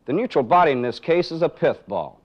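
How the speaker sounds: ordinary talk, nothing marked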